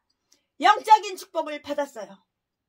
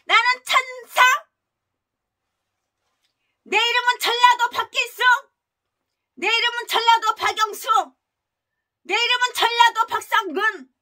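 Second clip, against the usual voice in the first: sweet, higher self-introduction as an angel; mock angel act; 5 semitones higher, 4 dB louder than usual. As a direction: put on a fake saintly mask. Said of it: "가면 딱 쓰고 완벽하게 연기를 하는 거야". (가면 쓴 천사 흉내, 위선적으로 높은 목소리)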